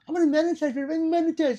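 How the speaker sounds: high mocking sing-song; mocking imitation. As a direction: parody foolish beginners. Mocking new gun tubers.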